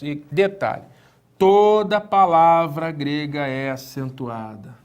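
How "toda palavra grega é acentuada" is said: slow emphatic rule. Shown as a demonstration. Teacher repeats the rule to memorize.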